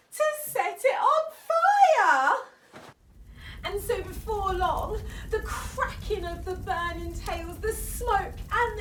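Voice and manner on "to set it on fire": high, loud dramatic rise; dramatic excitement. A narrator's reading. Narrator telling children the hunters lit the fire, high and loud. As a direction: make the fire sound thrilling.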